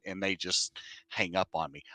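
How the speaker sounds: ordinary talk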